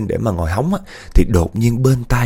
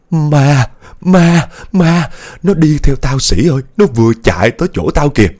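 panicked cry, higher and much louder; terrified trembling; 5 semitones higher, 6 dB louder than usual. A narrator's reading. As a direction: shriek it out in terror. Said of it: "giọng run run nói". (hoảng sợ run rẩy, la to)

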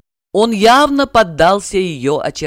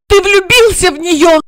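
high, loud furious accusation; furious blurt; 13 semitones higher, 6 dB louder than usual. A narrator's reading. Regina furiously accuses Igor, high and loud. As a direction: fire it at him in rage. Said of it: "яростно выпалила она".

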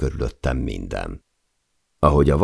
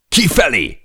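much higher, loud yelled command; bellow; 13 semitones higher, 5 dB louder than usual. A narrator's reading. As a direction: bellow it to get everyone out now. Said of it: "üvöltötte Per". (üvöltve, sokkal magasabban és hangosan)

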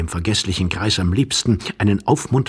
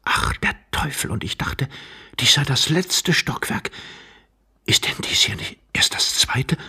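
breath-only muttered alarm; whispering young man; 5 semitones higher than usual. A narrator's reading. Young man thought this the top storey, whispering. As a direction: whisper it in dismayed surprise. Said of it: "flüsterte der junge Mann".

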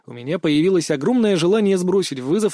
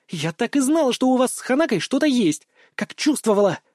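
gleeful or indignant; 7 semitones higher than usual. A narrator's reading. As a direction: exclaim in a high girl's voice. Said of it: "То ли радостно, то ли возмущённо завопила".

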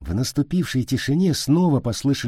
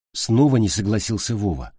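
ordinary delivery, nothing marked

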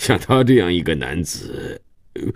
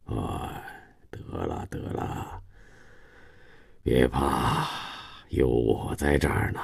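much softer, low and hushed; soothing comfort; 5 semitones lower, 10 dB quieter, slower than usual. A narrator's reading. Grandfather comforts his crying daughter-in-law, soft and low. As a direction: hush her gently like a frightened child.

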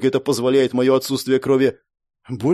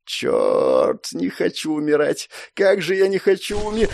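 strained, desperate pleading; dreading death; 5 semitones higher than usual. A narrator's reading. Wounded hero facing the killing spell, dreading death.

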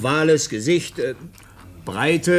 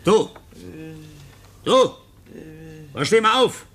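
raised, sharper calls; calling out to wake him. Acted. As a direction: rouse a sleeping boy. Waking the foundling, calling out.

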